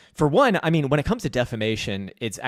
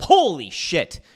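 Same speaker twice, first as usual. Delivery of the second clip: ordinary talk, nothing marked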